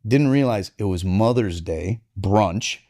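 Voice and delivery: ordinary talk, nothing marked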